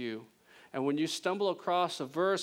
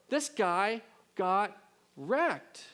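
ordinary delivery

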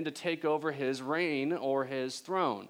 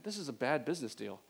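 normal talking voice

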